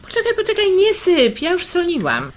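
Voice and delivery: wyżej i głośniej, gwałtownie powstrzymując; nagły alarm. Acted. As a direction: szybko zatrzymaj jej rękę nad garnkiem. Siostra powstrzymuje ją przed soleniem. (siostra w panice powstrzymuje siostrę przed soleniem, wysoko i głośno)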